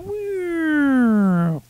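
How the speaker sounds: mock woo. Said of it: "It just went".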